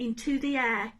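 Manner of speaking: usual speaking voice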